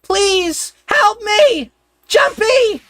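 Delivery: high, piercing cries for help; desperate screaming. Acted. Trapped sister screaming for her brother to help, frantic. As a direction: scream his name with all you have.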